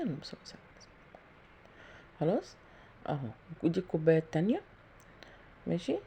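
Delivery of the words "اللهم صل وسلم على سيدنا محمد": quick prayer murmured under the breath; muttered prayer. Real, not acted. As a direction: rattle off the blessing softly to yourself. Muttered prayer while cooking.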